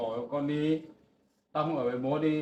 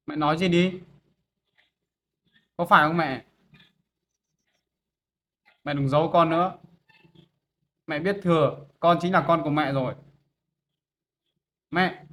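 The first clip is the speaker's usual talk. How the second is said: raised, insistent voice begging his mother to confess; pleading loudly; 2 semitones higher, 10 dB louder than usual. Acted. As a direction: push hard, almost shouting, desperate to hear yes. Son begs mother to admit it.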